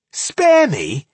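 loud, high, spat out in scorn; scornful. Acted. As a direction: spit the words back scornfully. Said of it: "she spat scornfully".

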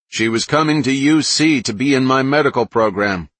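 loud and high, from another room; yelling. Acted. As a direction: shout it across the house. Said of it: "Paul yelled from the kitchen".

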